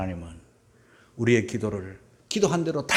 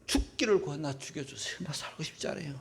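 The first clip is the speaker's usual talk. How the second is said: Elijah's plea, dropped to a near hush; despairing Elijah, hushed; 3 semitones lower, 10 dB quieter than usual. Retold or imitated. Elijah under the broom tree, whispering his wish to die. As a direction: let it sink almost to nothing, worn out. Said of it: "로뎀나무 아래 앉아서 뭘 합니까?".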